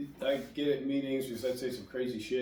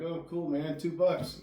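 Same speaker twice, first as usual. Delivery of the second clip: casual mock cheer; 7 semitones higher, 7 dB louder, slower than usual. Mimics newcomers at meetings.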